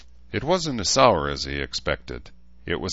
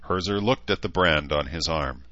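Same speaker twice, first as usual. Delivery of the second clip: ordinary delivery, nothing marked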